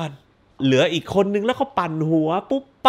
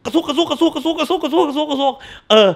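higher, repeated stab sound, laughing; gleeful stab sound effects; stabbing noise; 9 semitones higher than usual. Retold or imitated. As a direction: mimic the knife stabs gleefully.

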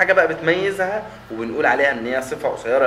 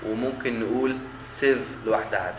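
ordinary talk

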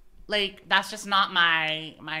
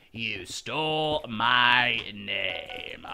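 slow, lower mock-sinister voice; slow mock menace; 11 semitones lower, slower than usual. Repeating the accusation to a teammate, slow mock menace. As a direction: draw it out like a villain.

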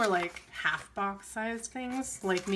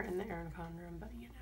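whisper; 8 dB quieter, faster than usual. Real, not acted. Whispered aside about planner sticker.

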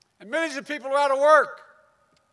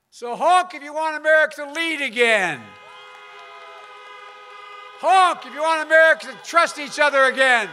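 rally speech; 2 semitones higher than usual. Real, not acted. Crowd rally, honking cars.

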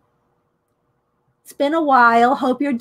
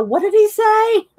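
higher-pitched, mock-baffled question; puzzled relatives; 9 semitones higher than usual. Imitating family members confused by step-grandfather's accent, puzzled. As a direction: imitate relatives who couldn't catch the accent. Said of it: "a lot of the family members go".

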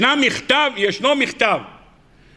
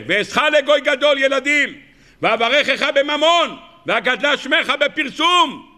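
solemn recitation; 6 semitones higher than usual. Acted. Preacher listing Abraham's promises, solemn intoning.

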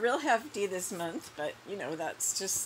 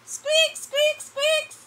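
very high squeaky calling voice; squeaky rubber duck; 8 dB louder, slower than usual. Pretend. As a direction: squeak the name like a toy.

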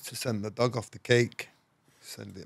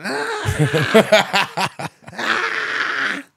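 high, loud faked screams of pain cut with laughter; hammed-up terrified cries and a shouted apology; fake screaming; 8 dB louder, much slower than usual. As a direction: scream and beg like a man being mauled, half laughing. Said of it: "Do your best scream".

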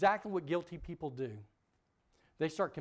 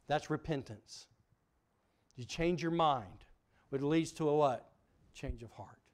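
ordinary talk

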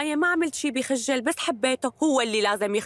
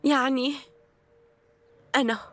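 bright presenter voice to the camera; host introducing her programme; TV host address. Acted. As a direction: announce it like a show host. Addresses viewers for a video.

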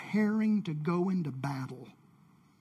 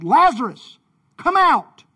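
loud, high-pitched shout; commanding call; 7 semitones higher, 12 dB louder than usual. A narrator's reading. Jesus calling a dead man from the tomb, commanding shout. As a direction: call him out with a big raised voice. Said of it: "And then Jesus called".

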